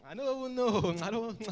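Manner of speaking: mock cool shrug. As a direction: show off not knowing as if it's cool. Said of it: "they make it seem so cool".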